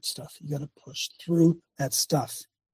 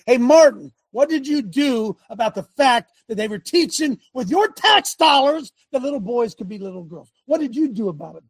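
the Lord questioning; 6 semitones higher than usual. Retold or imitated. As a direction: call him to account like a judge on the throne. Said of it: "he's going to say to you".

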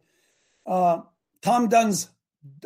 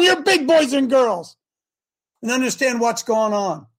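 usual speaking voice

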